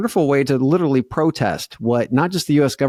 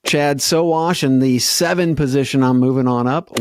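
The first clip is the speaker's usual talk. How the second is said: ordinary talk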